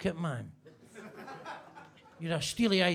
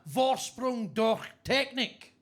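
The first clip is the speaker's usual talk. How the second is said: mock German slogan; 3 semitones higher, slower than usual. Quoting the Audi slogan.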